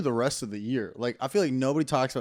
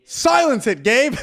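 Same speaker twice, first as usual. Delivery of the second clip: shouting; 11 semitones higher, 8 dB louder, slower than usual. Real, not acted. Yelling at Gabe to shush.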